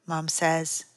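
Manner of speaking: usual speaking voice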